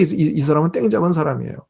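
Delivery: usual speaking voice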